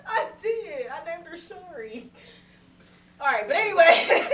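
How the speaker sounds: high, laughing protest. Real, not acted. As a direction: push through the giggles. Laughing at being teased.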